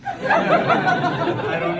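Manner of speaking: normal talking voice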